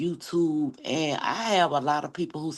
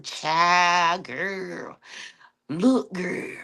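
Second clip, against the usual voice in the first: sassy imitation; much slower than usual. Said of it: "straight man be sitting up in my face talking about".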